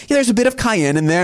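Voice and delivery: breezy salesman brushing it off; eager pitch. Retold or imitated. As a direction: founder downplaying the spice, quick and high. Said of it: "they're like".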